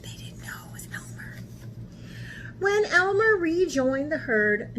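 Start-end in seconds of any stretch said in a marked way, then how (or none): 0.0-1.4 fast whispered secret; hushed conspiratorial aside. Real, not acted. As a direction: let them in on it in a whisper.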